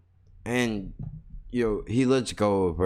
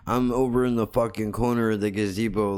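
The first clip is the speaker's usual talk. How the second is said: ordinary talk, nothing marked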